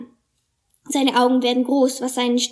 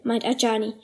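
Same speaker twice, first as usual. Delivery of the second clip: plain reading voice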